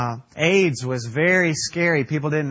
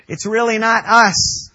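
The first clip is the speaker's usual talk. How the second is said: louder, higher punch on "It's really not us"; emphatic declaration; 6 semitones higher, 6 dB louder than usual. Shown as a demonstration. Preacher insists to the church, loud and emphatic. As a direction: drive "us" home to the room.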